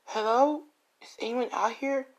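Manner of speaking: high, nervous calling into the woods; a frightened girl calling out, pitched high; girl alone in the woods calling out, high and uneasy. Acted. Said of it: "As the girl's like".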